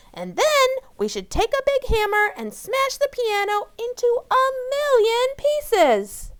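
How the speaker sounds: high, loud angry rant; furious. Acted. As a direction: rage at the piano lessons. Angry Amy wants to smash piano.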